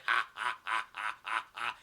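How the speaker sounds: low, spaced-out monster laugh; mock "ha, ha, ha" of a big brute; Yeti. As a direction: laugh in slow, low beats. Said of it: "the Yeti's like".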